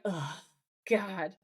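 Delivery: imitating quiet exasperation; exasperated sigh. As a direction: sigh it out, fed up. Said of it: "And Sam sighs, just like".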